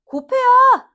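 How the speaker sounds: loud, high burst on the answer; emphatic exclamation. Real, not acted. Teacher leads students to multiply the two, loud and emphatic. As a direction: belt out the key step.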